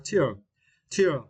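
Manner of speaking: Tier said alone, falling; stressed syllable drill. Shown as a demonstration. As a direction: isolate tier, land on it. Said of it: "stress the third syllable".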